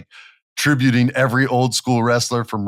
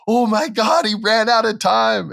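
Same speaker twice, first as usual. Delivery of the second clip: much higher, louder stunned cry; disbelief; 12 semitones higher, 4 dB louder than usual. Retold or imitated. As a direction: react like a cheated fan. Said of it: "I was like".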